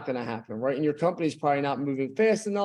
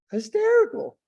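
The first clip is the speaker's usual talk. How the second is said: excited high pitch; 4 dB louder than usual. Retold or imitated. Retelling his hysterical laughter.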